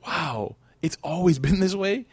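slow, high-pitched wondering question; amazed realisation. Retold or imitated. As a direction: imitate a reader astonished by the discovery. Acts out a reader's amazed reaction.

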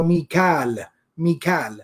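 Mikal in two syllables, mee-KAAL; different pronunciation. Shown as a demonstration. Teacher pointing out the verse's form, Mikal as mee-KAAL. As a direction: say Mikal with long aa, no eel ending. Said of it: "a little bit of a different pronunciation".

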